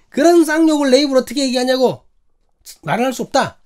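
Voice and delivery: high, loud mock indignation; indignant refusal. Quotes the offended woman's refusal.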